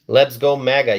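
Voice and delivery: normal talking voice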